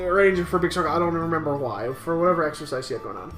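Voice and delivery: ordinary talk, nothing marked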